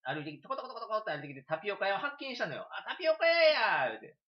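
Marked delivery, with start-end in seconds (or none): 2.7-3.9 high, loud cry of discovery; light up at finding the shop; excited discovery. Retold or imitated. Retelling finding the tapioca shop. (店を見つけて高く大きく喜ぶ声)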